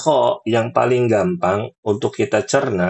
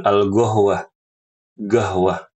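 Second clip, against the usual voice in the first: "gahwah" said with a hard G the Egyptian way; Egyptian-style gahwah; Egyptian G for qaf; slower than usual. Shown as a demonstration. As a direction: Egyptian Arabic pronunciation. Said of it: "diganti dengan G".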